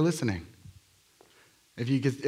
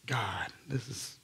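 slow, hushed, near-whispered prayer; awed hush; 11 dB quieter, slower than usual. Retold or imitated. Praying over a woman's spine, slow hushed awe. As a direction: breathe it to God in awe. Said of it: "I'm like".

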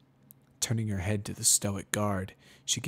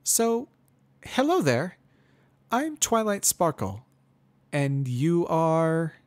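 put-on much higher, louder young mare, forced friendly; chirpy, slow, overly polite introduction; Twilight Sparkle; 9 semitones higher, 7 dB louder, slower than usual. A narrator's reading. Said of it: "She introduces herself".

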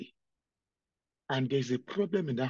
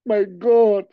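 louder wailing sobs to God; crying out loud; 4 semitones higher, 11 dB louder, slower than usual. Real, not acted. Weeping preacher cries aloud to the Lord. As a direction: let the sobbing overtake the words. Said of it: "The reason why I'm crying".

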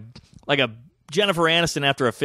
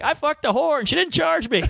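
triumphant brag; 12 semitones higher than usual. Retold or imitated. Bragging back to friends.

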